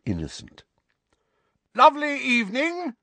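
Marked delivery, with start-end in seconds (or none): 1.7-2.9 very high, loud forced cheery shout; shouted greeting. A narrator's reading. Uncle shouting falsely friendly greeting to neighbour. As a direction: call across the street with fake cheer. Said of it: "shouted Uncle Vernon".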